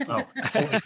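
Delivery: ordinary talk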